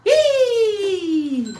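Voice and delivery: high whooping yee; excited yee. Real, not acted. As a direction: let out a happy yell. Excited shout.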